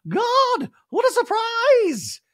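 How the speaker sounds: very high, loud, drawn-out surprise; Dorit impression. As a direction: act shocked in a squeaky put-on voice. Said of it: "And Dorit's like".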